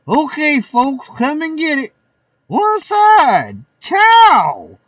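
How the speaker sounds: high, loud jolly calls; party host calling out. Acted. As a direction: bellow it over the party crowd. Godfrey serving food, guests.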